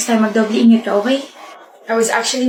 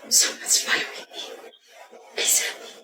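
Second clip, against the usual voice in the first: soft unvoiced whispering; hushed sweet talk; whispered; 10 dB quieter than usual. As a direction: breathe it as a secret.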